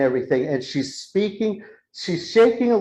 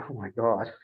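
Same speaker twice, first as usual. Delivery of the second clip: hushed dread; 5 semitones lower, 9 dB quieter than usual. Retold or imitated. Acting own hushed worry while watching.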